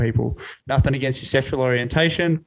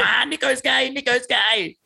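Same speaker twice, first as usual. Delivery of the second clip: mocking reporter; 8 semitones higher, slower than usual. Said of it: "He's just like".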